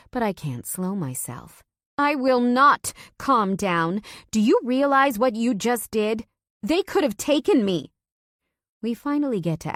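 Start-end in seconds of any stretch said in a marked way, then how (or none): 2.0-7.9 higher, louder furious refusal; wife refusing to calm down, shouting; angry shout. Acted. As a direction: yell it at him, outraged. Wife shouting back at husband.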